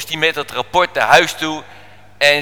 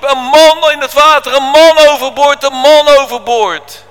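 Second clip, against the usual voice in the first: high, loud alarm shout; urgent calling out; 11 semitones higher, 10 dB louder than usual. Acted. Sailor alerting the crew, loud high call. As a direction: raise the alarm across the deck. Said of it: "die riep".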